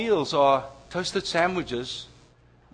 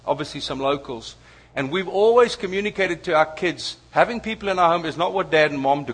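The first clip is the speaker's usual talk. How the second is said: ordinary talk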